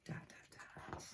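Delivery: breathy whispered build-up; whispered dot dot dot; suspense whisper. A narrator's reading. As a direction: hush them before the reveal. Suspense before the snore.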